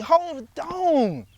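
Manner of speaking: high, loud exasperated protest; complaining disciples. Pretend. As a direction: whine like tired, fed-up followers. Disciples voiced complaining to Jesus.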